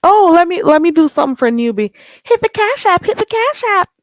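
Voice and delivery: high, loud hype call to tip; excited hype. Real, not acted. Streamer urging viewers to tip her friend, excited high call. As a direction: pump up the chat to hit the Cash App.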